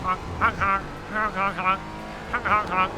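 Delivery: goofy goose honks; silly clown honking. Shown as a demonstration. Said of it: "that's the noise they make".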